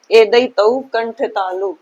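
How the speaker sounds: slowly spelled out एदई तऊ कंठ तालु; slow recitation. Shown as a demonstration. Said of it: "छठा सूत्र, जिसका नाम है".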